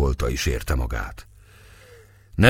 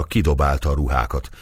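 usual speaking voice

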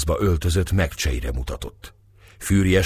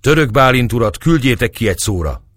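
raised, higher calling voice; shouted demand; 5 semitones higher, 4 dB louder than usual. A narrator's reading. Youth shouting to the guards in his way, raised voice. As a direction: call it out firmly to the guards. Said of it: "Gergely törökül kiáltott rájuk".

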